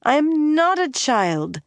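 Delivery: high, slow indignant protest; angry insistence. A narrator's reading. Elaine, called a child, protests angrily and loudly. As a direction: stamp it out, offended. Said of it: "She stamped her foot angrily".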